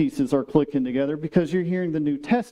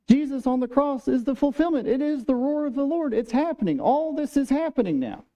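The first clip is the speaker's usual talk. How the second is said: fervent preaching; 8 semitones higher than usual. Real, not acted. Preacher builds to climax.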